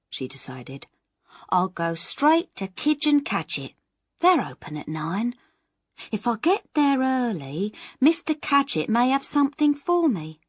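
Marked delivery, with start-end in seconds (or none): 1.5-10.4 lightly voiced, a bit higher and louder; a touch of eager hope in her plan; hopeful Miss Moss. Miss Moss pins her hopes on Mister Cadgett, lightly eager voice.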